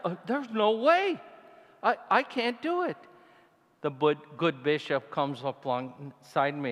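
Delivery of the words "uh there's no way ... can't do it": scared. Retold or imitated. Scared on the cliff edge.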